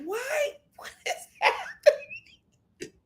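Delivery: very high, drawn-out laughing bewilderment; baffled disbelief. Retold or imitated. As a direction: squeal it out in laughing confusion. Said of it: "I'm like".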